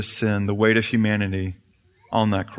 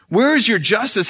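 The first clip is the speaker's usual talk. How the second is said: high cry; 10 semitones higher, 3 dB louder than usual. Acted. Satan demanding justice.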